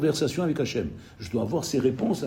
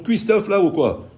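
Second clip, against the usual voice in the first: exasperated, pitched up and louder; impatient outburst; 7 semitones higher, 5 dB louder than usual. Retold or imitated. A distracted cook fed up that his egg won't cook, exasperated. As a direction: demand it like someone fed up waiting.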